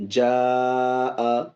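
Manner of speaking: reciting the madd muttasil words in full; long madd recitation. Shown as a demonstration. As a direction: stretch each madd fully. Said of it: "تو یہ ہو گیا مد متصل".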